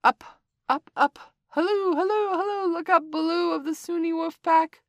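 high, loud kite calling down from above; calling from the sky. Acted. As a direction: shout down to the bear from the air. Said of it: "to see where the voice came from".

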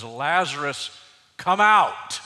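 loud, high commanding shout. Acted. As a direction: call the dead man out of the tomb. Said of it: "he shouts".

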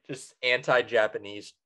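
ordinary delivery

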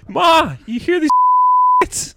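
high, loud put-on holler; mock yell to Ma. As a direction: call out to Ma in a hammed-up voice. Acted yell to mother about kids.

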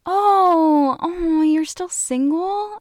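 high, slow mock-pity voice; exaggerated pitying relative. Relatives at Christmas, mocking their pity. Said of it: "people are like".

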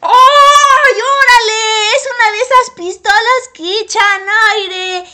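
high, loud, delighted cry of surprise; thrilled squeal over a new toy; excited discovery. Acted. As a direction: gasp with joy at the find. Finds a magic hair dryer.

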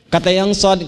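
normal talking voice

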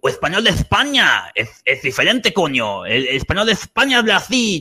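mock Castilian Spanish accent; Spain Spanish accent. Shown as a demonstration. Guest showing how Spain speaks, mock Castilian accent. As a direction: put on a Spaniard's emphatic accent. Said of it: "assim, desse jeito".

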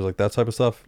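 ordinary talk, nothing marked

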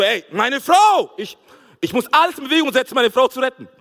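loud action-hero yell, pushed hard; heroic shout. Retold or imitated. Husband yelling that he must rescue his wife, loud and heroic. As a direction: bellow it like a movie hero charging in. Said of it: "ich war Tom Cruise, Hulk und Superman".

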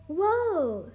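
amazed cry. A narrator's reading. Miguel amazed by the holographic horses, crying out. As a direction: gasp it out in awe. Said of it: "cried Miguel".

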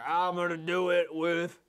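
slow, hammed-up hero voice; macho exterminator. Exterminator declares his plan, hammed-up bravado. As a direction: announce the big weapon with slow bravado.

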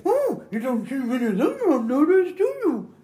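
high, small-mouthed frog voice; punchline voice, high frog hiding its wide mouth; frightened frog. Acted. Frog fooling the snake, high altered voice. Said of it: "He goes".

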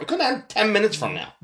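talking between sips of coffee; words around a sip of coffee; sipping coffee. Real, not acted. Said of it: "Sorry, I'm drinking my coffee".

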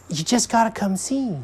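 high little squirrel voice; eager squirrel. A narrator's reading. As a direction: urge them in a high creature voice.

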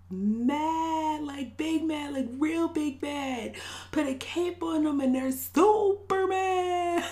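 mocking exaggeration. Real, not acted. Mocking how mad folks are.

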